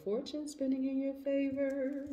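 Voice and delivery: high, drawn-out squeal of delight; excited squeal. Real, not acted. Reader thrilled at the Cancers' Wheel of Fortune card, high squeal. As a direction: gush over the good card.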